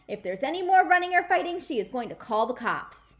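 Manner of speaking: high, loud, fast shouted warning; shouting a rule. Acted. Nana shouting the new rule across the yard, loud. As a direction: bellow the rule through cupped hands. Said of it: "she shouted out a brand new rule".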